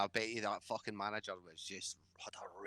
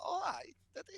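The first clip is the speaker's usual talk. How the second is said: very high, mock-surprised coworker; high mock gasp of a gossiping colleague. Mocking coworker reaction.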